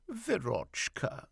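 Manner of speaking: high, slow, tearful call; a drawn-out plea in a high voice; tearful mother. A narrator's reading. Mother calling her daughter before sobbing, tearful. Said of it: "but then she sobbed and stopped".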